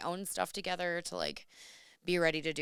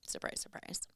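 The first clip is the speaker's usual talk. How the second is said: dry whispered aside; deadpan, whispered sarcasm; sarcastic whisper; 9 dB quieter than usual. Real, not acted. Sarcastic aside about lateness.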